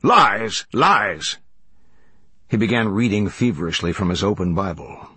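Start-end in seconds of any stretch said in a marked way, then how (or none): 0.0-1.4 mildly raised, indignant exclamation; crying out. A narrator's reading. Reverend denying the charges, crying out. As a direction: protest in two forceful, separated bursts. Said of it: "cried the Reverend".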